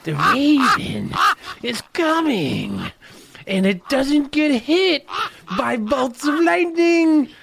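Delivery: high, slow creepy raven. Acted. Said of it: "You lost your Raven voice".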